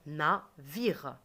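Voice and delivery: "Navire" split into na, then vire; "Navire" in two detached syllables. Shown as a demonstration. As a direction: tap out "Navire" in two beats. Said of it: "Deux fois".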